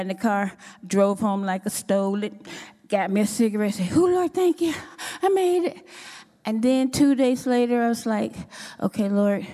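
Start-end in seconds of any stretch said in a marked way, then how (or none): 4.0-5.9 higher, delighted sigh of relief; relieved thanks. Retold or imitated. Finally lighting up after work, thanking God in a higher, relieved voice. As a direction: thank the Lord like you just crossed a finish line.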